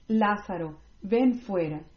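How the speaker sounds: usual speaking voice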